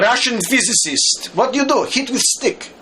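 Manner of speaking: high-pitched, hammed-up Russian physicist; mock Russian accent, high and punchy. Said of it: "I'll do the Russian accent".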